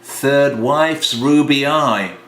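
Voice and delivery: plain reading voice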